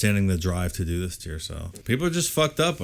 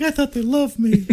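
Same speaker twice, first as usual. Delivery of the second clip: mock hurt; 3 dB louder than usual. Mocking a hurt friend.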